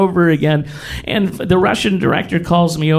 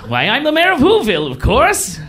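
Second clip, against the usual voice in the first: mayor character; 9 semitones higher than usual. Retold or imitated. Playing mayor of Whoville at party.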